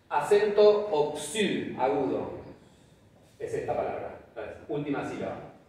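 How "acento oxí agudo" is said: slow, emphasised. Shown as a demonstration. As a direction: draw out "acento" for emphasis. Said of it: "Significa oxítona".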